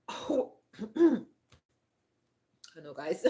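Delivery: throaty, catching voice with a frog in throat. Real, not acted. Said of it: "I seem to have some kind of frog in my throat".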